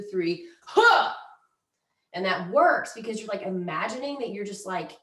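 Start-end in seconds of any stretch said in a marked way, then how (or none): 0.0-1.1 louder forceful "huh" on the chop; chopping grunt. Shown as a demonstration. Instructor demos the wood chopper count and chop, forceful grunt. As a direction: grunt it out as the hatchet falls. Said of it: "you count to three and you go".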